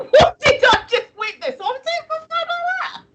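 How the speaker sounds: high squeaky words through laughter; helpless laughter. Real, not acted. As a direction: laugh so hard the voice shoots up. Helpless laughter at what she saw.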